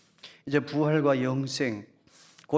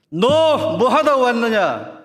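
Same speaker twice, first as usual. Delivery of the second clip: slow, much louder booming voice of God; God's stern question; 8 semitones higher, 12 dB louder, much slower than usual. Acted. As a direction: boom it down slowly like God judging. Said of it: "하나님 질문하실 거예요". (하나님의 엄한 질문)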